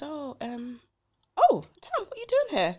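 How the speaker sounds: high, startled sister's voice; surprised sister. Retold or imitated. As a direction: voice the sister caught off guard, pitched high.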